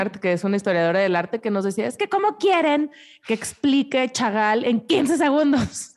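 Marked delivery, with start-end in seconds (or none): 1.9-5.9 exasperated, pitched-up complaint; frustrated. Retold or imitated. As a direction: mimic an exasperated historian. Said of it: "Súper frustrada". (tono frustrado e indignado)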